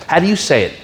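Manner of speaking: usual speaking voice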